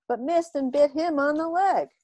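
ordinary delivery, nothing marked

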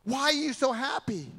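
high, baffled asking; puzzled researchers. Retold or imitated. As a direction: ask it like you can't believe the joy. Puzzled researchers question the boy.

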